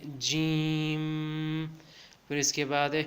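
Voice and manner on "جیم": جیم very slow, ending in a held hum; drawn-out letter. Shown as a demonstration. Teacher showing the final m sound, long جیم. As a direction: stretch جیم, "jeeeemmm". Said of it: "آخر میں میم کی آواز آئے گی".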